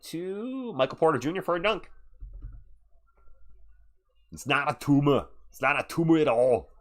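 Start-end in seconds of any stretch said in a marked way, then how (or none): 4.3-6.7 put-on Austrian action-hero voice; Austrian accent. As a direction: protest it in a mock Austrian accent.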